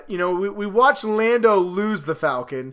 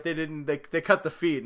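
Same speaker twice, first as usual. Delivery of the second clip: ordinary delivery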